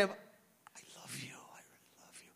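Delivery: breathy whispered I love you, slow and tender; sincere whisper. Shown as a demonstration. Preacher demonstrating sincere love to God, whispered. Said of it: "kind of say".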